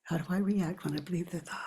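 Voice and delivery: soft unvoiced whisper of the question. Shown as a demonstration. Teacher asking herself the inquiry question in a whisper. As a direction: breathe the question inward. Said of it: "leading self inquiry whisper".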